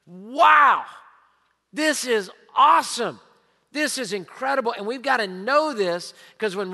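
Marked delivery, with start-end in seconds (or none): 0.0-4.7 a reader struck by the passage; amazed wow. Retold or imitated. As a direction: gasp at the verse in awe. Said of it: "laid my pen down and went".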